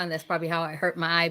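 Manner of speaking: ordinary talk, nothing marked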